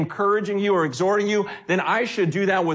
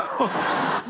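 burst of laughter. Real, not acted. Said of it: "I just go".

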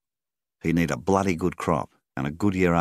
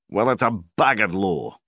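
louder, higher angry yell; 6 semitones higher, 8 dB louder than usual. A narrator's reading. Farmer yelling at the banker over the law, loud. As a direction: shout it at the banker in outrage. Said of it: "Milvan yelled".

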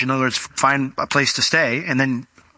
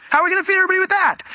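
Peter voiced; much faster than usual. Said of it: "Peter kinda looking over at Andrew going".

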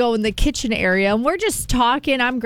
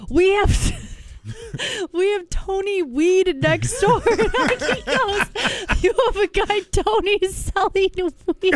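laughing through quote; 8 semitones higher than usual. Retold or imitated. Retelling while cracking up.

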